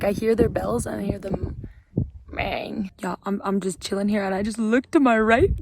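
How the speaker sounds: ordinary talk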